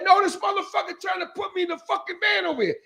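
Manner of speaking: high, yelled protest; woman yelling for help as a man drags her; retold shout. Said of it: "She's like".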